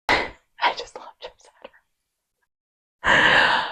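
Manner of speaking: sigh, then soft whisper; adoring whisper. Real, not acted. Fan sighing over a favourite singer, whispered adoringly.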